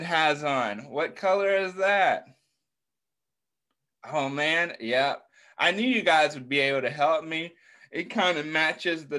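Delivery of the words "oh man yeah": usual speaking voice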